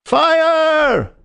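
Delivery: very high, loud alarm shout; loud yell. A narrator's reading. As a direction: bellow it down the corridor. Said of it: "The shout must have rung over Norwood".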